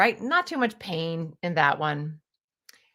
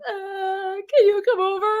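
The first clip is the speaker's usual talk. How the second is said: much higher, tearful plea; crying Sally; 11 semitones higher, 4 dB louder than usual. Retold or imitated. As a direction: whimper it like Sally on the phone. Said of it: "she calls him".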